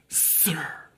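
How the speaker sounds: low, soft "Sir"; demonstrated tone. Shown as a demonstration. Man by the pool answering Jesus, low and soft. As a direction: address him softly, dropping the pitch. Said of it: "or certain tones".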